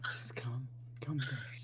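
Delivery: hushed. Acted. Echoing the creepy invitation.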